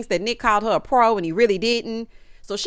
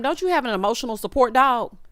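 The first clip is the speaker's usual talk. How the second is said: ordinary talk, nothing marked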